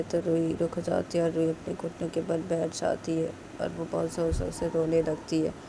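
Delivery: usual speaking voice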